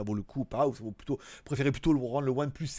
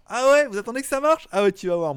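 mock indignation; 6 semitones higher, 7 dB louder than usual. Mock indignation at freeloading viewers.

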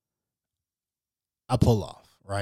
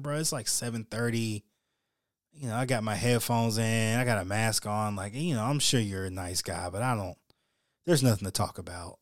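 quiet brush-off; 7 dB quieter than usual. Retold or imitated. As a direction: tell a chatty guy you're busy without raising your voice. Muttered reply to chatty stranger.

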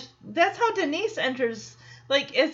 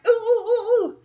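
high, loud oohing; hammed-up eager classroom oohs, laughing; imitating eager pupil; 10 semitones higher, 6 dB louder than usual. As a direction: beg for the teacher's attention with your hand up. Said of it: "how she'd get the attention of a teacher".

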